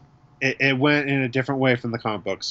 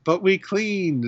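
put-on higher, slow childish objection; imitating kids; 8 semitones higher, slower than usual. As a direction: protest innocently like a little kid. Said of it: "they're like".

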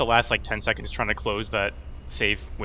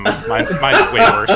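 laughing; 8 semitones higher, 10 dB louder, slower than usual. Real, not acted. Laughing at own drawing.

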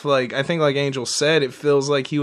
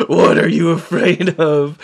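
louder, lightly put-on interrogator voice; skit villain quote; 5 dB louder than usual. Fan retelling a Batman skit, lightly acted questioner. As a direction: imitate a sketch character's questioning. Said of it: "Pete Holmes Batman skit".

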